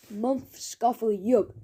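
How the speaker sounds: slow words garbled by food; snorting through a mouthful. Acted. Mister Gum cramming kebab, mouth full and slow. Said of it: "snorted Mister Gum".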